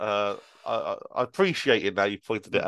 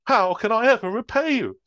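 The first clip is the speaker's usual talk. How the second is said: put-on high, louder old lady voice; grateful old lady; 12 semitones higher, 5 dB louder than usual. Old lady thanking Superman, high grateful voice. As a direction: gush thanks like a granny. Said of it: "asked the old lady".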